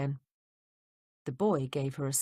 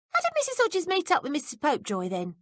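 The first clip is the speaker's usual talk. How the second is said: put-on higher, curious young maid; higher maid's voice, prying; 11 semitones higher than usual. A narrator's reading. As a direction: play the nosy young maid, pitched up. Betsy voiced by reader.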